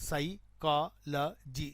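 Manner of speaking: Psychology slowly split into sai, kaa, la, jee; syllable by syllable. Shown as a demonstration. Teacher counts the sounds in psychology, syllable by syllable. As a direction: break psychology into four separate sounds. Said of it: "నాలుగే".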